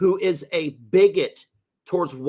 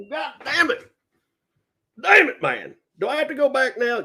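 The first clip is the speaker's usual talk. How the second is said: hillbilly character voice; 10 semitones higher than usual. Acted. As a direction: play an indignant hillbilly. Dick the Hick again.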